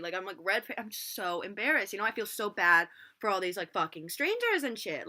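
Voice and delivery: ordinary talk, nothing marked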